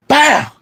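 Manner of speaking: sudden loud, high shouted impact noise; imitated bang. Son mimicking the crash of dad punching a wall, explosive. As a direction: burst out with an explosive crash sound.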